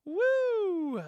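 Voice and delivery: high, loud "woo"; long falling cheer; whoop. Real, not acted. Reacting to the city from the balcony, whoop.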